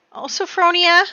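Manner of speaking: high, loud gasp of her name; alarmed cry. Real, not acted. Player fearing her Sim Sophronia died, alarmed. Favourite Sim's fate revealed.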